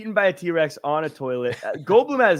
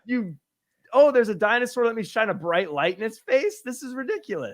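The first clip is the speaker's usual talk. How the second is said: mocking the kids; 6 semitones higher than usual. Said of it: "I was like".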